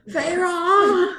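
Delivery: high, strained, weak call of her name; dying whisper of a name. Retold or imitated. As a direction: breathe her name as if fading. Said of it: "he's like".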